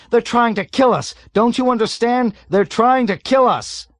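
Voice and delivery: high, loud desperate shouting; terrified shouting. A narrator's reading. Terrified pilot shouts warnings at navigator. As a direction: scream the danger at him.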